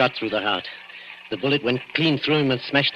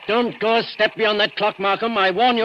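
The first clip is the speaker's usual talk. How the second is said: threatening warning; 8 semitones higher, 3 dB louder than usual. Acted. Man threatens with revolver.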